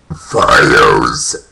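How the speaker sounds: word burped out low and loud. Pretend. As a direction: burp the word out. Burping vlog, word burped.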